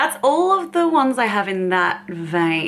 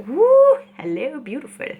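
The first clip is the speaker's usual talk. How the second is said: excited woo; 7 semitones higher than usual. Retold or imitated. Voicing the light catching shade.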